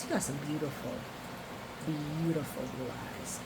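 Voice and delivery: soft, low and dreamy; hushed swoon. Real, not acted. Host swooning over his blue eyes, hushed. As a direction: sink into a quiet, admiring murmur.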